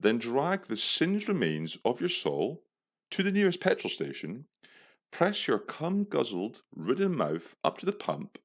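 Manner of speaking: high, swooping theatrical declamation; mock-indignant tirade. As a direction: declaim each insult like a grand proclamation. Reads out his insulting reply.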